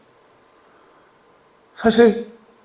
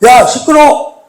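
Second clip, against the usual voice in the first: loud, higher scolding yell at a beggar; crowd yelling; 7 semitones higher, 6 dB louder, slower than usual. Acted. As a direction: snap at him to shut up.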